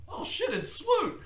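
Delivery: drawn-out surprised oh shit; shocked realization. Retold or imitated. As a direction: react as if spotting a famous rival. Recognizing a top player.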